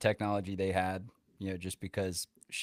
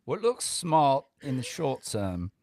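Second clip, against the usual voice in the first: slow, higher and louder mock English accent; hammed-up English accent, drawn out then eased off; 4 semitones higher, 4 dB louder, slower than usual. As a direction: read the lesson like an overdone English gent. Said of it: "you have to read lesson number six in an English accent".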